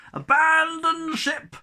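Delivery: very high, loud, slow yell; captain's yell. A narrator's reading. Captain yelling as he jumps overboard. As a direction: bellow it in panic. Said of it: "yelled Captain Jeggings".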